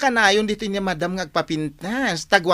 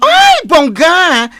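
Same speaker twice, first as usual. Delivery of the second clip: excited; 8 semitones higher, 8 dB louder, much slower than usual. Acted. Gossiping friend exclaims.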